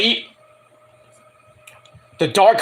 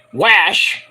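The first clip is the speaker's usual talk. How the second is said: Boston accent; 2 semitones higher, 3 dB louder than usual. Shown as a demonstration. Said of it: "sounding like you're from Boston".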